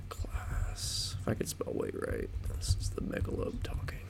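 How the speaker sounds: unvoiced tipsy whisper. Real, not acted. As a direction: whisper it to yourself, a bit buzzed. Tipsy aside while spelling.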